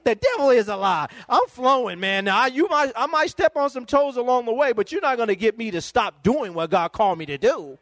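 high-pitched, fired-up declaration; impassioned refusal to be stopped; defiant preaching. Real, not acted. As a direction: push back with rising preacher fervor. Preacher defiantly answers those opposing him.

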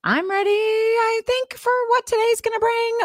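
waver upward as if doubting you are ready; unsure. Shown as a demonstration. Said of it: "Or you can be like".

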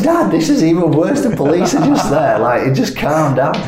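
high, loud, laughing plea to an angry dad. Retold or imitated. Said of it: "I'm saying".

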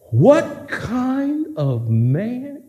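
slow, soft awestruck question; awed wonder. Acted. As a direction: marvel under your breath. Disciples awed.

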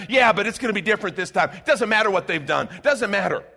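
stubborn voter. Retold or imitated. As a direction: brush off the record. Quoting voters defending politician.

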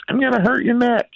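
very high, squeaky parroting of a parent; high mock-kid singsong; mocking little daughter. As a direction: mimic them in a tiny child's pitch. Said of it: "she just mocks me and goes like".

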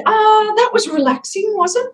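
high-pitched mock-impressed retort; sarcastic. Coach retelling her reply to a panicked client, sarcastic. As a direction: send it up high, meaning the opposite.

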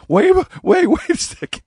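high, loud panicked wait; alarmed kid. Retold or imitated. Boy realizes grandma will kill his duck, panicked high shout. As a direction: stop her mid-sentence in shock.

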